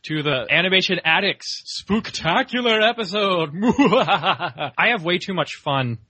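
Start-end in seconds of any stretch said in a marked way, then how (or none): 0.0-3.5 high, theatrical haunted host; spooky host. Pretend. Spooky haunted-house host greeting. 3.5-4.7 laughing. Real, not acted. Laughs after spooky intro.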